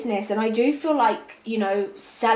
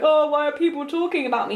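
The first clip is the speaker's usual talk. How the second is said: a lightly voiced whiny plea, higher; mock hurt self-pity; 5 semitones higher, faster than usual. Reader mocking the hurt reaction she denies, mock whine. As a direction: imitate a wounded, emotional celebrity. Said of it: "like, emotional".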